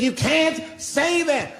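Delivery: high-pitched, drawn-out mock wail; imitating crying woman. Crying audience member objects to comedian's jokes, imitated tearful outburst. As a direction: stretch it out high, mimicking a tearful protest. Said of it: "she was crying".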